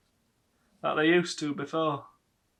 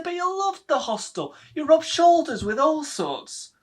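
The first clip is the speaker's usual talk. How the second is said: much higher, chirpy mother coaxing; lightly voiced mother talking him round; mother's voice; 12 semitones higher, 6 dB louder than usual. Mother voiced, pushing the hostel.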